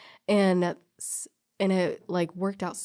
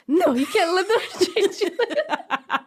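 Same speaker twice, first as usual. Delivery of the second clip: higher, laughing imitation of an indignant dad; laughing dad impression; 12 semitones higher, 6 dB louder than usual. Retelling her protective dad's outrage, laughing through it. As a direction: play dad protesting while you crack up. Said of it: "my dad is like".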